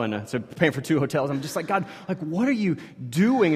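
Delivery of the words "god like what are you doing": higher, pleading cry of disbelief; frustrated dad asking God why, higher and exasperated; exasperated appeal to God. Retold or imitated. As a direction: throw the question up at God.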